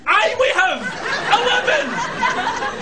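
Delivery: exasperated Scottish outburst; angry yelling. Acted. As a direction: yell it, dragging out the last word. Yelling back at the lift.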